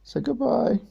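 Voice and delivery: squeaky high hello to the bird. Real, not acted. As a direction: chirp it up high for the pet. High pet talk.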